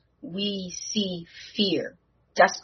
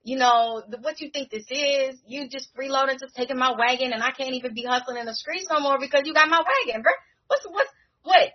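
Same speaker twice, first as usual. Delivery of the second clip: put-on higher, streetwise kid ranting about his wagon; lightly voiced indignant kid, fast and pitched up; Landon hustler voice; 6 semitones higher than usual. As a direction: complain like a hustler who wants his wagon back. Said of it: "and he's like".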